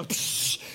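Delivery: "psh" of pressurized water; imitated hose spray. Showing thumb over the hose, spray noise. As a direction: mimic water jetting past a thumb.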